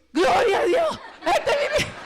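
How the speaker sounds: high, loud, laughing praise; the priest hails him, high and loud; priest's joyful exclamation. Retold or imitated. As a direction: cry out in joy like the priest. Priest exclaims after the song.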